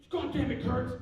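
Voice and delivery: high, loud angry phone outburst; angry yelling. Retold or imitated. Company man phones the cartoonist, imitated angry yelling. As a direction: imitate a caller blowing up at you. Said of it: "he's yelling at me".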